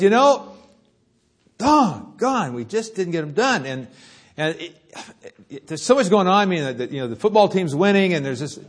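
high, loud excuse-making; hammed-up students complaining; whining students. Students excusing late papers to the professor, high and loud. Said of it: "And this time it was".

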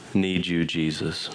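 normal talking voice

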